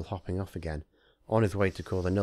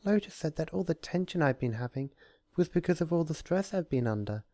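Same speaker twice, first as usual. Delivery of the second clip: much higher gentle cheerful voice; cheerful Fluttershy; 8 semitones higher than usual. Acted.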